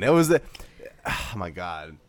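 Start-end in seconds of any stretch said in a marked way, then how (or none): none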